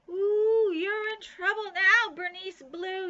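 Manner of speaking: high, loud sing-song taunt; taunting yell. A narrator's reading. Nancy yells after the fleeing Bean, loud taunting. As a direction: gloat as loud as you can. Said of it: "yelled Nancy".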